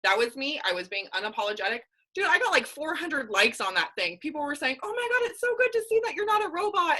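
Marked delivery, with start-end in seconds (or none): 4.8-7.0 high, excited gushing comment; gushing fan. Retold or imitated. Followers gushing over her pizza post, high and excited. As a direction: squeal it like a delighted follower.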